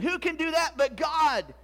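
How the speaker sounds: loud, high, slow challenge; outraged Pharisee. Acted. Pharisee objecting to Jesus' claim, outraged. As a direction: demand it loudly, drawn out.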